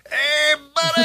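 high, bright mock shout of a greeting; hammed-up buddy greeting; brother's cheery call. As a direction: call out like the little brother showing off. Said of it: "you would hear him go".

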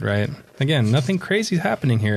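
ordinary delivery

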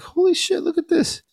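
very high-pitched mock amazement; retold astonishment. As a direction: react in a high, amazed squeak. Retold amazed reaction to chaos.

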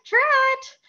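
put-on "Tret" said very high like a little girl; tiny girl voice. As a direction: squeak "Tret" high as a toddler. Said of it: "she calls me Tret".

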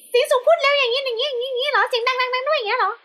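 very high-pitched rapid chatter; squeakily high, rattled-off demo; high, fast voice. Shown as a demonstration. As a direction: imitate a city bird's high, hurried speech.

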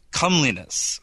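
COMELINESS with a short "uh", as in "come"; first pronunciation offered. Shown as a demonstration. Host offers variants of an uncertain word, COMELINESS said "KUM-lee-ness". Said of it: "however it's pronounced".

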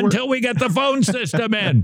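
high-pitched, impatient mock demand; hammed-up pleading question; mock exasperation. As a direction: badger your producer in pretend outrage. Host mock-demands a fix from Jimmy.

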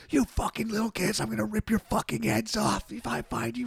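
high, strained mock yell held down in volume; pretend rage. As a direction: fake fury at kids, yelling in a squeezed high voice. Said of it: "but I will pretend I'm".

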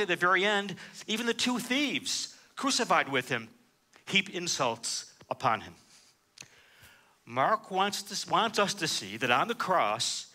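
usual speaking voice

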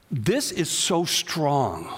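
usual speaking voice

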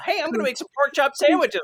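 high put-on voice; cartoon kid. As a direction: pipe up like a cartoon child. Quoting parody cartoon kid.

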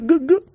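rapid, high-pitched glug-glug noises; imitated drinking sound. Friend mimicking how drinking potion sounds, glugging. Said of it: "That's how it sounds when you drink".

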